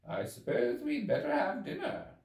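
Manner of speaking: a hint of a brisk doctor proposing dinner; medical man. A narrator's reading. Medical man suggesting dinner to the guests, lightly voiced.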